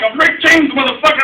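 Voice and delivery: loud, high-pitched boastful outburst; going off. Retold or imitated. Storyteller reenacts taunting his friend on camera, loud going off. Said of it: "it made me just go off".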